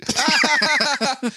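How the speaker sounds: bursting into high laughter; real burst of laughter at own joke; big laugh. Real, not acted. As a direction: crack up loudly at your own pun.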